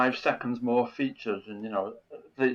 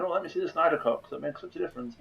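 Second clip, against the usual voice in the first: mocking fanboy voice, higher and fast; sarcastic mock eagerness; 6 semitones higher, much faster than usual. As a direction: sneer at fans who say this. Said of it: "first day saying".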